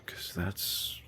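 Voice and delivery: breathy whispered aside. Real, not acted. Streamer whispers an aside to himself while building. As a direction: whisper it under your breath. Muttering to self while playing.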